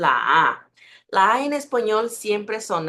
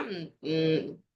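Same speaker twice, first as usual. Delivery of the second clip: usual speaking voice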